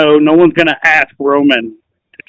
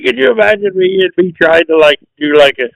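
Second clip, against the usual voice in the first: normal talking voice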